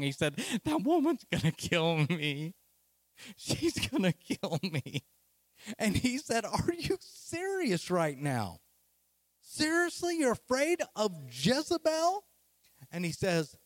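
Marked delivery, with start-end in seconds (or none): 0.6-5.0 mock-whimpering, put-on scared voice; whimpering fear. Elijah's fearful complaint acted out. 6.4-12.2 acted astonishment, swooping way up high; incredulous. God's incredulous reply acted out.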